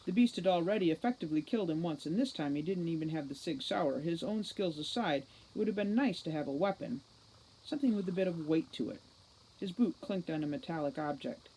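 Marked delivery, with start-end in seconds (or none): none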